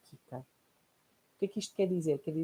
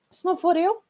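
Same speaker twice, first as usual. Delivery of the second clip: higher, louder mock complaint; imitating the heroine mother; 12 semitones higher, 7 dB louder than usual. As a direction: play the long-suffering martyr. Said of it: "quantas vezes nós ouvimos isto". (imitação da mãe heroína, mais aguda e alta)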